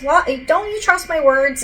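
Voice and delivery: mock-affronted, pitched well up; high-pitched retold "don't you trust me" protest; retold challenge. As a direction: voice his challenge with a jump in pitch. Retold challenge to her doubt.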